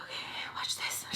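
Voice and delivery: very soft, voiceless aside; secretive whisper. Acted. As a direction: whisper it under your breath.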